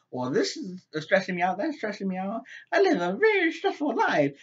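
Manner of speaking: high-pitched, exaggerated complaining; mock stressed-out housewife. Sheila griping to her friend about her life, mock stressed.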